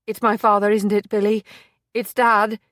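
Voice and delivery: the realisation hits her; dread. Acted. Maisie to Billy, fearing for her father.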